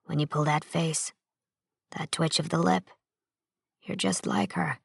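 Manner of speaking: low, soft murmur of memory. A narrator's reading. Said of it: "he murmured".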